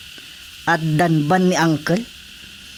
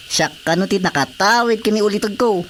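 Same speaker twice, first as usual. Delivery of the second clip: usual speaking voice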